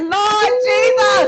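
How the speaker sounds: high, slow, loud cry of praise; overjoyed Lord Jesus exclaim; joyful praise. Retold or imitated. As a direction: stretch it out like a shout to heaven. Daughter wants to sign up.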